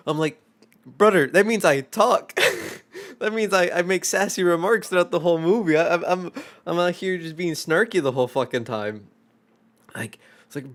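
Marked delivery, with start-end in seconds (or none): 0.9-9.1 loud, high-pitched retold protest; exasperated explanation. Explaining to coworker what chirping means, loud exasperation. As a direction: spell it out loudly in amused disbelief.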